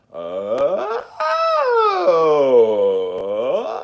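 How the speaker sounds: loud rising vocal slide; vocal warm-up siren. Shown as a demonstration. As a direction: belt out a rising warm-up exercise. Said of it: "we can do the little".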